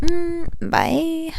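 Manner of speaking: a coy little farewell; sweet. Real, not acted. High soft bye.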